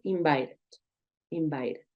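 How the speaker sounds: "invited" with the T softened to an R; T sounded like R. Shown as a demonstration. Teacher models "invited" for Spanish-speaking learners, T as R. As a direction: tap the T in "invited" like an R. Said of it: "sonar como una R".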